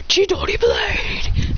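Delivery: high, drawn-out breathy technique cry; rasped anime battle cry; attack call. Acted. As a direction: stretch the move name out high. Calls out an attack move.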